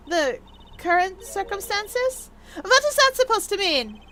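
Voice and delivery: slow, high, rising character question; high, suspicious demand for explanation; incredulous echo. Acted. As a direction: repeat it back in high-pitched disbelief.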